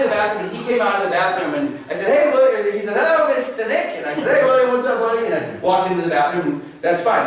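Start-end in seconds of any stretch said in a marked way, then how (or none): 2.1-2.7 cheery call. Retold or imitated. Greeting a child in hallway. 3.0-4.0 put-on little boy greeting. Child greeting teacher. 4.4-5.3 bright, higher, louder hello; cheery greeting. Retold or imitated. Teacher greeting a little boy, cheerful and loud.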